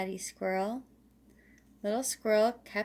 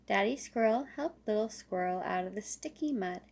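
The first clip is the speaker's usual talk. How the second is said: plain reading voice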